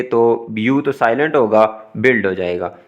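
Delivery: ordinary delivery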